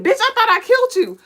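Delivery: high, loud shocked outburst; shocked villain. Retold or imitated. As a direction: disbelief at the survivor. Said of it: "they're like".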